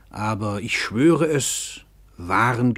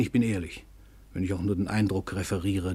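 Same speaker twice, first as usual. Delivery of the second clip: normal talking voice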